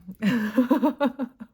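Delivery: loud, high burst of laughter; real laughing fit. Real, not acted. Reader insisting they aren't mocking anyone, laughing hard. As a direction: crack up helplessly, high and loud. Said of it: "I'm not laughing at them".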